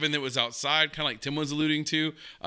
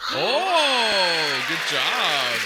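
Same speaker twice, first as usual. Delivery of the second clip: delighted burst, higher and louder; excited; 4 semitones higher, 6 dB louder, much slower than usual. Real, not acted. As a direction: cheer them on loudly. Excited reaction to show clip.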